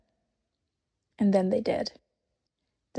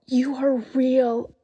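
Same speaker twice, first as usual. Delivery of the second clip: breathless joy; 3 semitones higher, 4 dB louder, slower than usual. A narrator's reading. Lucy overjoyed at Aslan.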